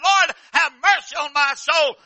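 loud, slow, high wailing plea; dying cry. Acted. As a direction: wail it out as your last breath. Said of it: "died crying".

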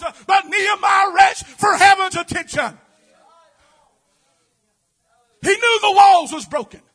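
loud, building preacher's cry; full-force preaching. Acted. Preacher crying out to the congregation, full force. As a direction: drive it home to the church.

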